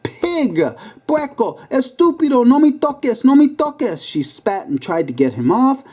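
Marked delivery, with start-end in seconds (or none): 0.0-4.0 put-on high, furious shrieks at the boys; yelling in Spanish. A narrator's reading. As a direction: scream her off like an angry neighbour. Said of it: "as she yelled".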